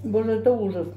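eating a burger between words; talking while chewing. Real, not acted. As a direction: mouth partly full of food. Seen 0: Bites at -4, appears to chew, bites again near 4.8.

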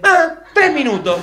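high, loud comic echo; mock exclamation. Argentine mocking the wrong estimate, high exclamation.

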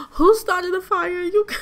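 high, loud shriek of disbelief; shocked outburst. Real, not acted. Gamer reacting to a sudden fire in the game, shocked outburst. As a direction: cry it out, shocked, breaking off into a laugh.

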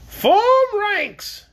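high, loud commanding shriek; a queen shrieking orders at her army; shrill queen's cry. Acted. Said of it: "she cried in her shrill voice".

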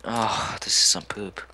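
soft, slow, unvoiced grumble; whispered aside. Real, not acted. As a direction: whisper it to yourself. Muttered aside.

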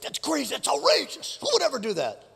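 high, strained outrage without much volume; mock outrage. David reacts to Nathan's story, acting outraged. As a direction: exclaim it high and incredulous, as if scandalized. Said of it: "David's like".

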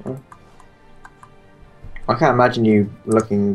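ordinary talk, nothing marked

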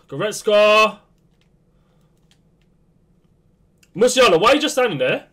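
loud, fast, exasperated yell at the screen; frustrated shout. Real, not acted. Gamer yelling at his footballer to move, exasperated shout. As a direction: bark at the player for standing still.

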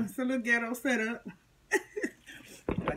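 laughing through the words. Real, not acted. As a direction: chuckle while you talk. Laughing at her setup.

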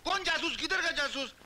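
high, exasperated questioning; indignant denial. Acted. Beggar told he is a detective, indignant high denial.

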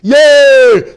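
loud joyful whoop; excited cheer. Real, not acted. Pastor cheering the congregation, a loud joyful whoop. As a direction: lift the room with a cheer.

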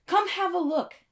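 high, loud eager call; eager invitation. A narrator's reading. Victor inviting friends to see drawings, eager. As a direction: wave them over with excitement. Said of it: "he said".

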